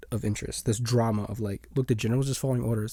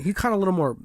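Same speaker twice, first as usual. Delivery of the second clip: usual speaking voice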